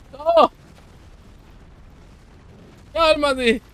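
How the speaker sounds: high, loud burst of protest; alarmed shout. Real, not acted. Player to the attacking boss, high alarmed shout.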